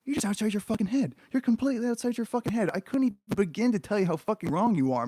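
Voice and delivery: incredulous, building outrage at how wrong it is; exasperated rant. Real, not acted. Ranting at the cartoon's claim.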